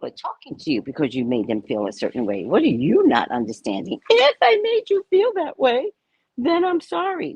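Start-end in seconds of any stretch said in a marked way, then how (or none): 4.1-7.3 mocking, very high-pitched quote; exaggerated high mimicry, leaning on "if"; Oprah. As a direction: parody a hedged apology in a squeaky high voice. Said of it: "Oprah goes".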